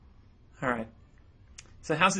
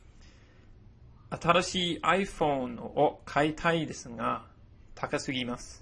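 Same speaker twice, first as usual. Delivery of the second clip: slow model sentence; 2 semitones higher than usual. Shown as a demonstration. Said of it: "Have a look at the next few examples".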